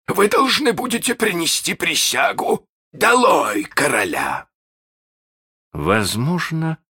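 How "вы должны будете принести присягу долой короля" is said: high theatrical order building to a shout; commanding pirate. Acted. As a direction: demand the oath, drawing out the final cry. Pirate captain demands an oath.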